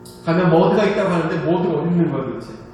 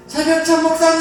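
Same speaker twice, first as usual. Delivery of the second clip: high, louder call for the pastor; 10 semitones higher, 4 dB louder than usual. Retold or imitated. Blind with mud, calling the pastor by name loudly. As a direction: shout across the beach for help. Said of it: "하면서 막 불렀어요".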